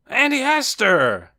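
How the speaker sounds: high, loud, drawn-out hail; joyful cry. A narrator's reading. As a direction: cry out to a beloved aunt. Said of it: "cried Casper".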